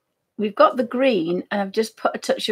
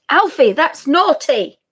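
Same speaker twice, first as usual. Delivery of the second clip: louder, slow telling-off; scolding; 5 semitones higher, 9 dB louder, slower than usual. Real, not acted. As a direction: tell the dog off. Scolding dog.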